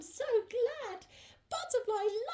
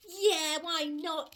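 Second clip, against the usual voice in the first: ordinary delivery, nothing marked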